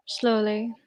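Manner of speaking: normal talking voice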